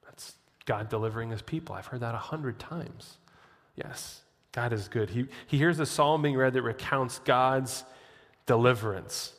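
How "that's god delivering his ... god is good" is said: soft, much quieter dawning realization; hushed recognition. Retold or imitated. Asaph hearing scripture in the temple, hushed and wondering. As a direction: hush it like a private discovery. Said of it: "he goes".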